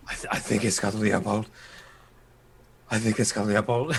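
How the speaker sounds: weak, hoarse mumble; hoarse mumbling. Acted. Wounded Weimar mumbles about Leopold, lightly acted hoarse mumbling. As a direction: mumble it as if badly hurt. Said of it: "with a very hoarse voice".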